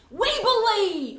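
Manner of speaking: loud, slow, laughing affirmation; joyful declaration. Real, not acted. Teacher rousing the church to affirm belief, joyfully. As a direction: proclaim it with glad conviction. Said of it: "affirming the church's faith".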